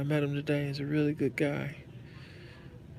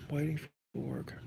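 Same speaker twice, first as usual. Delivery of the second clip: under the breath, whispered to himself; a private whisper, almost unvoiced; 6 dB quieter than usual. Real, not acted. Repeats himself under his breath.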